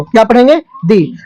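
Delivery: ordinary talk, nothing marked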